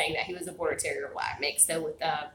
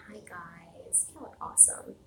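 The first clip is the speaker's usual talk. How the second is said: soft whisper; 16 dB quieter than usual. Real, not acted. Greeting viewers softly on camera.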